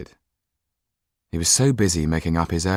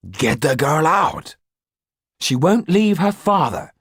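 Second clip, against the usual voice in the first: put-on young man voice; 11 semitones higher, 5 dB louder than usual. Jan voiced higher.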